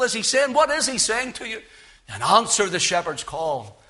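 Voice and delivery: normal talking voice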